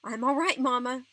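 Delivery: shouting back. A narrator's reading. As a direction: call back loudly across a distance. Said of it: "I shouted".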